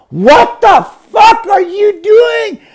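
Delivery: high, slow shrieking "What the fuck are you doing?"; startled shout. Retold or imitated. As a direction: shriek it in shock, drawn out. Woman catches him on roof.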